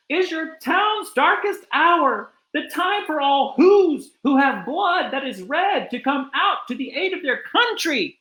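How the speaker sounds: high, urgent mayor's call; rally the town, pitched high; mayor crying out. A narrator's reading. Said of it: "cried the mayor".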